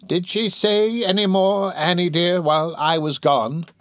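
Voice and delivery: high, slow crone voice asking eagerly; old crone messenger. Acted.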